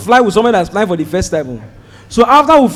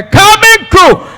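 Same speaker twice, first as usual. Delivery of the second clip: announcement; 7 semitones higher than usual. Retold or imitated. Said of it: "I hear".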